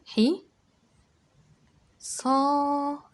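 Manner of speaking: high and loud, صا held long; stretched syllables. Shown as a demonstration. Teacher stretches صا so children hear the long vowel. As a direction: hold the vowel of صا out. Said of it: "الصاد طولت فيها".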